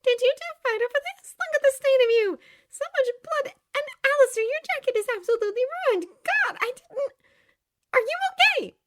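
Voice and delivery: high-pitched, loud, alarmed daughter; worried Charlie. Acted.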